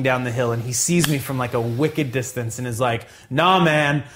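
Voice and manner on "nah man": higher, louder imitation of an exasperated teacher; retold outraged protest; acted fed-up refusal. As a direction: act out someone who has had enough. Teacher confronts him, acted refusal.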